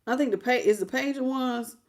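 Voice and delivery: ordinary delivery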